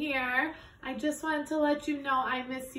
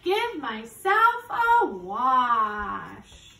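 slow drawn-out; 4 semitones lower, much slower than usual. Acted. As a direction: stretch every word slowly. Repeat of the line after chant.

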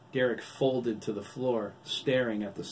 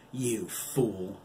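a touch of an angry cry. A narrator's reading. Wolf scorns the doctor, mildly crying out. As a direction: cry out in scorn, without much volume. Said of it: "Billy cried".

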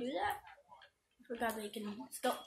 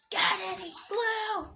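slow, high, loud yell; shouted begging; 9 semitones higher, 5 dB louder, slower than usual. Pretend. Repeats louder, shouted.

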